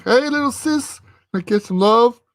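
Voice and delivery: very high, playful imitation of a cheery brother; exuberant greeting. Long-lost brother greets his little sister at the door, exuberant. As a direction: pitch way up for a big hello.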